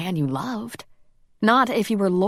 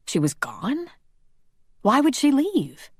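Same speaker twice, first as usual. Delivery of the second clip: pitched up high in stunned disbelief; shocked disbelief; 8 semitones higher than usual. Acted. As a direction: ask it as if the news just hit you. Stunned Robbie asks in disbelief.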